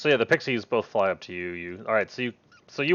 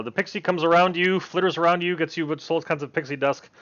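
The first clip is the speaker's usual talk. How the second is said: usual speaking voice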